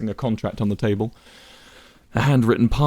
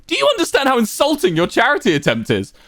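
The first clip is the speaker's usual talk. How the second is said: indignant. A narrator's reading. Wilbur insulted, furious.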